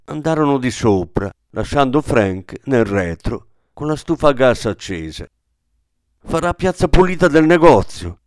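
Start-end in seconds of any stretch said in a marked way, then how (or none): 6.2-8.2 louder, higher angry warning; angrily. A narrator's reading. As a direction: spit it out in anger, louder. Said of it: "disse Ida con rabbia".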